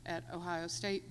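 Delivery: usual speaking voice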